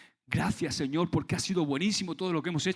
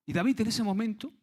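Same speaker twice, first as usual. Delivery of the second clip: ordinary talk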